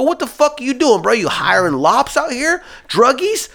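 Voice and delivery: high, incredulous mock outrage; indignant rant. Driver scolding his boss over who gets hired, indignant. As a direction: challenge him in a high, exasperated voice.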